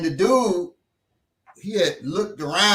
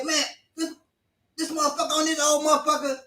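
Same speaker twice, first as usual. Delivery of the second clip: cocky threatening dude; 8 semitones higher, faster than usual. Retold or imitated. Dude threatening old man.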